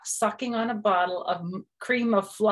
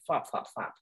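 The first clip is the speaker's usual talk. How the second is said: soft flop sounds; 5 semitones lower, 6 dB quieter than usual. A narrator's reading. Sound effect.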